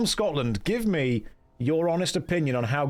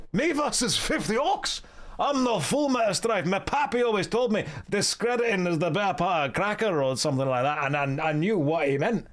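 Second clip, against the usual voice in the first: put-on Scottish accent; 2 semitones higher than usual. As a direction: protest indignantly in a hammed-up Scottish accent. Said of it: "That was a perfect Scottish accent".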